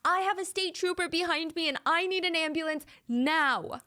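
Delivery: high-pitched mock scream without added volume; imitated screaming caller. Narrator retells caller's plea to emergency dispatcher, mock scream. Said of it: "The caller is screaming into the phone".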